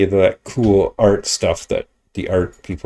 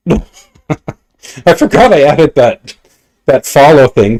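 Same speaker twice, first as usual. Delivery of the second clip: startled laugh, louder and higher; 6 semitones higher, 9 dB louder, slower than usual. Real, not acted. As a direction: laugh it out, caught off guard. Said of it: "That kinda startled me".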